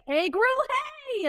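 high, rising playful echo; mock sing-out catchphrase; imitating his excited reply. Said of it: "he was like".

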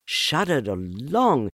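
ordinary delivery

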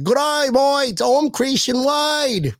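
high, drawn-out imitation of an accent, sounding mock Australian. Fan imitating a former Bills player, high exaggerated imitation accent. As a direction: do a hammed-up, high-pitched imitation accent, stretched out slow. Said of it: "I try to do his imitation".

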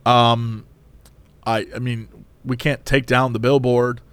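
low, flat drive-through worker; mild weary cashier voice; tired employee. Retold or imitated. Cashier answering angry customer, low and weary.

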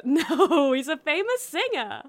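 laughing through the words; cackling at her own meme. Acted. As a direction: giggle while explaining the joke.

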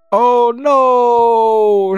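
loud, high-pitched acted cry; hammed-up damsel cry of alarm; mock dismay. A narrator's reading. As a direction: wail it loudly in pretend horror. Captive's dismayed cry, read out.

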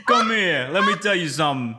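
hammed-up New York wiseguy; mock Bronx tough guy. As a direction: play the Bronx tough guy, half laughing. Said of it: "You sound like you're from the Bronx".

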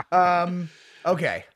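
ordinary talk

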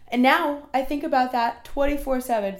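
yawning mid-sentence; words stretched by a yawn. Real, not acted. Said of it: "Sorry, I'm yawning".

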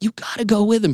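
urging on. Retold or imitated. Passengers urging him on.

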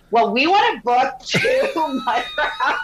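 retold reply breaking into laughter; laughing retort. Guest retells asking the owner for mud wraps, laughing.

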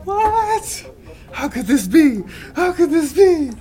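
very high, loud, laughing disbelief; mock shock. Fan acting Goku's friends stunned, high laughing squeal. Shocked over dead grandfather.

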